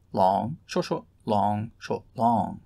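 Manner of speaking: long short syllables chanted with rising tune; scanning the pattern aloud; rhythm recited. Shown as a demonstration. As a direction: chant the metre, leaning on each long. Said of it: "The gluconic".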